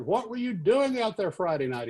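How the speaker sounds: high-pitched indignant demand; acted-out telling-off; angry. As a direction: imitate an irate boss. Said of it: "was really angry".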